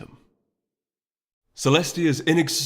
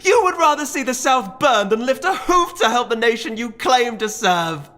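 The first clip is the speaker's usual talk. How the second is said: high, loud furious charge; Celerity screaming an accusation at Emmett; 13 semitones higher, 6 dB louder than usual. A narrator's reading. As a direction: shout it over the whole room. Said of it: "Celerity was screaming to be heard".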